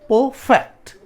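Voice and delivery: Perfect loud and high, फेक्ट punched; stress on second syllable. Shown as a demonstration. Teacher confirming where stress falls, second syllable punched. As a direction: split perfect and hit फेक्ट. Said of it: "फैक्ट पे".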